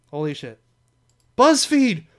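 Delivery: usual speaking voice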